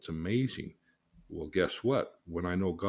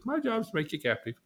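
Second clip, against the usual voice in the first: mock Lucifer; 13 semitones higher, faster than usual. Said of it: "He comes along and says".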